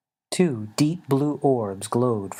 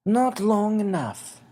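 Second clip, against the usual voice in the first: put-on higher, slow regretful old voice; little winged man, sorrowful; 10 semitones higher, slower than usual. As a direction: break bad news gently to a friend. Xavier's voice.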